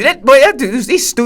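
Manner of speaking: normal talking voice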